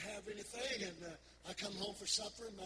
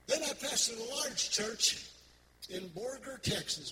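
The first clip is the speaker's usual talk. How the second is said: preaching; 3 semitones higher, 5 dB louder than usual. Acted. Preacher builds the next point.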